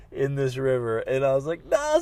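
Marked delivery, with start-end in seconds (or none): 1.7-2.0 high-pitched cry of disbelief; crying no at the sight below; shocked cry. Retold or imitated. Husband sees his wife sitting in the river, high cry of dismay.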